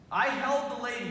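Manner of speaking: ordinary talk, nothing marked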